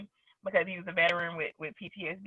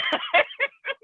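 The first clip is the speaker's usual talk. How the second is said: high laugh. Real, not acted. Laughing at the story.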